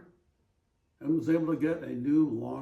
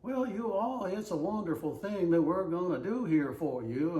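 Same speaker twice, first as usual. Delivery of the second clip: mock Southern accent; 7 semitones higher than usual. Showing how people in the South talk, mock Southern accent. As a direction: lay on a folksy Southern accent. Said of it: "how about somewhere maybe in the South?".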